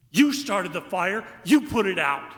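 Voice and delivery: put-on squabble like an indignant kid; high-pitched child's yell. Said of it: "and a high-pitched".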